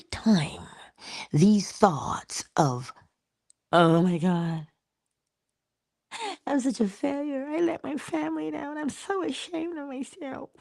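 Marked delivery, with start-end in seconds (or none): 3.7-10.5 ashamed inner voice. Acted. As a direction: let the shame shrink the voice to nothing. Acting out guilty inner thoughts.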